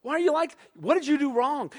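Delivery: mildly mock a coworker's panic; incredulous coworker. Speaker asking a petrified coworker, lightly mocking. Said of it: "I'm like".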